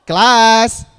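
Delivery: loud, high, drawn-out class call; attention call. Shown as a demonstration. Trainer demonstrates getting a class's attention, loud drawn-out call. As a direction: call the whole class to order, stretching it out.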